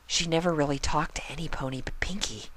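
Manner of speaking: quick soft whisper; hushed quick whisper; confiding whisper. Acted. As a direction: share a secret quietly. Shy pony confiding.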